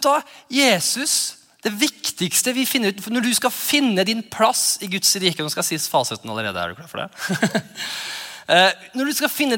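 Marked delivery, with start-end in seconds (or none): none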